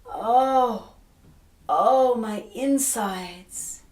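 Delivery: crying out in pain. Acted. Ereshkigal in labor crying to the flies, slow loud wail. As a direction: moan it out slowly, in agony. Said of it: "Ereshkigal cries out".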